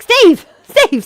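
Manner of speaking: high, loud holler of a name; calling out. Retold or imitated. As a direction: call down the road to someone. Calling out to Steve down road.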